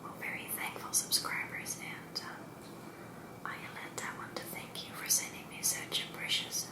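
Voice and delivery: hushed, unvoiced-breath whisper to the viewer; ASMR whisper. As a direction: whisper it softly, close and intimate. Whispered first part of video.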